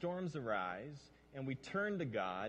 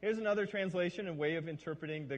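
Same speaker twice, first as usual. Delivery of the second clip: ordinary talk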